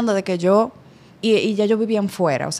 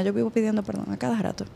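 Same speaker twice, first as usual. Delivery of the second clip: softer and fast, half to herself; tossed off quietly to her co-host; quick quiet aside; 9 dB quieter, faster than usual. Real, not acted. Admitting to her co-host, quick and softer. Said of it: "¿Tú qué?".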